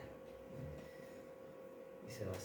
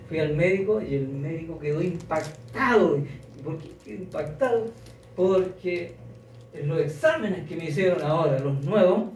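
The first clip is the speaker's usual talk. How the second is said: joyful testimony; 3 semitones higher, 7 dB louder than usual. Retold or imitated. Woman tells of her miracle.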